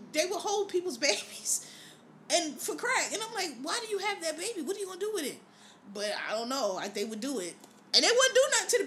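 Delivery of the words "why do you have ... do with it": baffled questioning. Retold or imitated. Acting out own baffled reaction.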